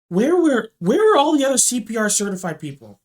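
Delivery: retold exasperated plea; exasperated disbelief. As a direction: demand to know where the help is. Retold frantic thought during emergency.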